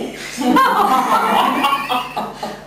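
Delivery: high delighted laugh. Real, not acted. Trainer delighted by the performance, high laugh. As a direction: burst into a bright laugh.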